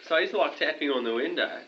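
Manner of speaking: ordinary delivery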